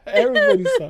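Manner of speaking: very high, laughing; high squeal through laughter. Real, not acted. Laughing at his own embarrassment.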